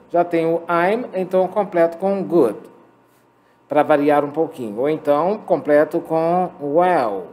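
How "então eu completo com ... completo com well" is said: normal talking voice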